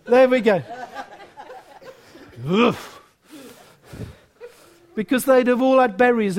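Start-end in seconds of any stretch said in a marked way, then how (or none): 2.4-3.0 put-on low French-accented puff; hammy French accent; best French accent. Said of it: "That's my best French accent".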